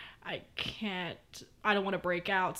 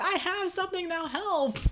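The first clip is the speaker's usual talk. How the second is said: much higher, proud announcement; triumphant; 11 semitones higher than usual. Retold or imitated. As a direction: present the solution with glee. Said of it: "pulled it out of my bag and went".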